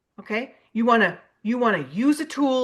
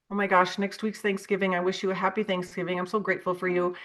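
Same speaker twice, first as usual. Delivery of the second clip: soft warm note; 4 semitones lower, 6 dB quieter, faster than usual. Retold or imitated. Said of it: "Maybe I'll say".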